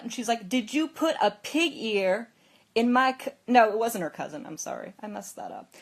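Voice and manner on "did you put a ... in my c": louder, higher accusing question; retold angry confrontation; angry yelling. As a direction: demand an answer, loud and accusing. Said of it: "ran in here yelling at you".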